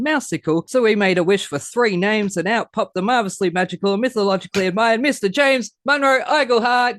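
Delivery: game-show announcer hyping the guest; hype intro. Acted. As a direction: roll out the big rhyming intro like a ringmaster. Host's rhyming hype intro.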